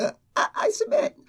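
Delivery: very high, squeaked-out surrender; squeaky surrender. Retold or imitated. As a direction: give in with a tiny strained high voice. Mother crushed, giving in.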